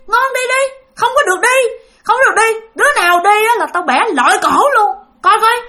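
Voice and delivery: shrill-free high furious rant; furious threat. Retold or imitated. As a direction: snarl at them like a possessed hag. Possessed grandmother threatening children.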